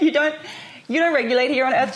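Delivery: high, loud imitation of a Czech friend; Czech accent. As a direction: put on a Czech accent, amused and emphatic. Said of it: "she says it in a Czech accent".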